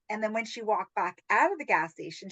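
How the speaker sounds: ordinary talk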